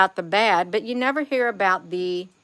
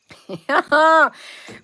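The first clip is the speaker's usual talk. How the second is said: real laugh; 8 semitones higher, 7 dB louder than usual. Real, not acted. Laughs at endless negativity.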